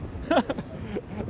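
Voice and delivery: soft, high laugh; amused laugh. Real, not acted. Streamer hit by wind, amused laugh. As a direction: laugh softly at the surprise.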